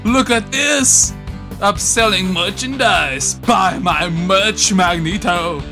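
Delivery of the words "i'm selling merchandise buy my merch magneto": ordinary talk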